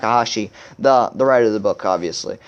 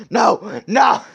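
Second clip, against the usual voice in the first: higher, wailed protest; mock dismay; 8 semitones higher than usual. Real, not acted. Cringing at his awful accent attempt, mock-dismayed wail. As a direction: wail in embarrassment at your own performance.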